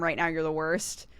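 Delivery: ordinary talk, nothing marked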